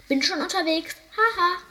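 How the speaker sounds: high, bright call with a cackle; laughing gull calling. A narrator's reading. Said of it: "rief die Lachmöwe".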